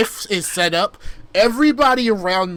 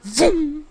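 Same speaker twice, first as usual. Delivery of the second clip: fall sound; 11 semitones higher than usual. Retold or imitated. Mimicking the fall off a cliff.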